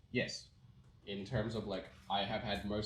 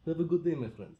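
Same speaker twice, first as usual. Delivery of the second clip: higher, louder mock-Russian menace; threatening Russian accent; 6 semitones higher, 6 dB louder than usual. Shown as a demonstration. Showing friend how Russian sounds, threatening Russian accent. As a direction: wish them well like a threat in a Russian accent. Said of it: "it always sounds like a threat".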